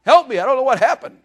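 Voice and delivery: loud high desperate cry to God; loud mock cry for help from the water. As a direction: call out for help like you're sinking. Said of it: "You have to cry out".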